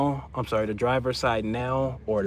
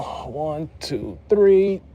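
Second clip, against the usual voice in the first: straining pull; 5 semitones higher, much slower than usual. Real, not acted. Counting into a big pull.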